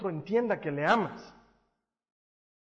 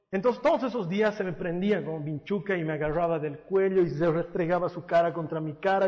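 ordinary talk, nothing marked

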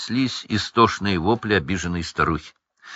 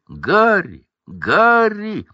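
name called twice, higher the second time; loud calling out; 11 semitones higher, 4 dB louder, much slower than usual. A narrator's reading. A voice hails Harry in the street, calling out. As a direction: call out high with a long falling tail. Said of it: "громко позвал чей-то голос".

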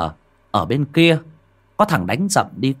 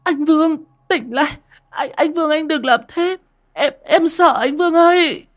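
very high, sobbing pleas; crying out; 4 dB louder than usual. Acted. Girlfriend begging the strangling boy, wailing and crying. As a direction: cry to him through terror. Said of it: "khóc toáng lên".

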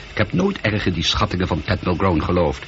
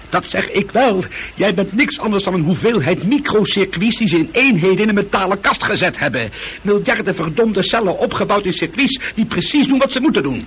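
higher, heated tirade; angry outburst; 10 semitones higher than usual. Acted. Astronaut rages at his computer, heated higher tirade. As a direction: rant at the machine in anger.

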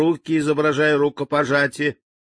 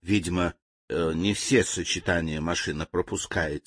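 soft, low and even; calm answer; 6 semitones lower, 5 dB quieter than usual. Acted. Servant calmly explains the machine's fault to the general. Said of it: "спокойно ответил слуга".

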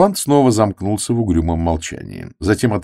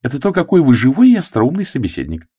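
higher, mock-admiring young woman; sarcastic; 8 semitones higher, 4 dB louder than usual. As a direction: needle him with fake praise. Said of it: "сказала Флора язвительно".

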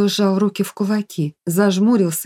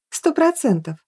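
higher, assured agreement; agreeing; 7 semitones higher than usual. A narrator's reading. Sofia backs her friend's opinion, assured. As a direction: agree with full conviction. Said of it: "согласилась София".

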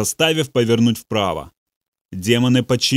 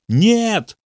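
frantic yell, much higher; 9 semitones higher than usual. A narrator's reading. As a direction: bellow the refusal in panic. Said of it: "заорал Бобби".

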